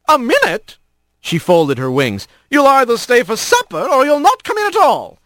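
a fond mom scolding lightly; cheerful mother hawk. Acted. Mother greeting her son at the door, high and bright.

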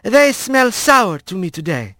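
lightly voiced squirrel, slow then lifted; a hint of a fussy friend steering Rat away; squirrel speaking Manx. Alphonse coaxing Rat away from the booth, lightly voiced. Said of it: "and said in Manx".

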